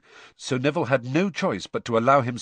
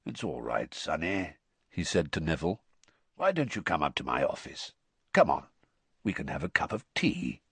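much lower and softer, kindly rasp; calm the frightened boy with a soft low growl; Moody gently coaxing Neville in a low growl; low gentle growl; 5 semitones lower, 5 dB quieter than usual. Acted. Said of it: "a much lower and gentler growl".

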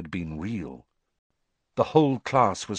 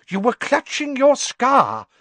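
high, loud professor voice; excited insistence; 6 dB louder than usual. A narrator's reading. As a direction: press the student with excited certainty. Excited professor presses the student.

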